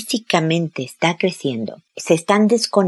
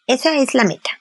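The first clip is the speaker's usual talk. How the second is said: usual speaking voice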